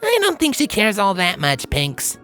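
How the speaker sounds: deadpan in a high character voice; Rainbow Dash. A narrator's reading. Pegasus answering her bouncy friend, deadpan. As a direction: answer drily, pitched high. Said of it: "Rainbow deadpanned".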